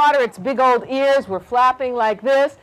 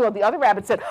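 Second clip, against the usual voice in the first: usual speaking voice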